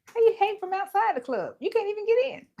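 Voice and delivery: high, fast-rising mock taunt; playful song-quote taunt. Host quoting a [name] lyric to viewers, playful taunt. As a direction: toss out the lyric way up high, breaking into a laugh. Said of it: "What did [name] song say?".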